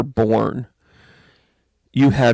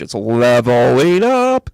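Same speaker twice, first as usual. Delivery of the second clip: "leveling up" drawn out slow and louder; "it's a leveling up" slowed with a loud rising "up"; stressed leveling up; 5 dB louder, slower than usual. Real, not acted. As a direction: lean hard on "leveling up". Stressing the key point.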